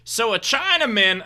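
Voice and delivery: CHINAMAN as one word, pitched high; imitated joke teller. Driver starts joke for comedian, "Chinaman" said eagerly as one word. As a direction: launch eagerly into a joke, "Chinaman" run together. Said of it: "And he goes".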